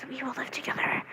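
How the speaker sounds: soft, breath-only whisper; dreamy whisper. Retold or imitated. Fan imagining life with the cosplayer, whispering. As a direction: whisper it like a secret fantasy. Dreamy fantasy in fan's head.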